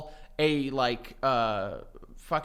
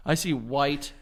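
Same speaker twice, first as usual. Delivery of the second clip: usual speaking voice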